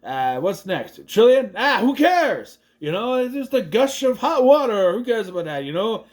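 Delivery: high, carefree shrug of a voice; lightly voiced careless captain; dismissive captain. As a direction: play it like nothing matters. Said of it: "He's like".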